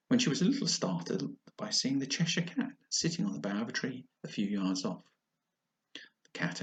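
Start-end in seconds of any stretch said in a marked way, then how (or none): none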